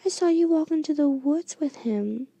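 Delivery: usual speaking voice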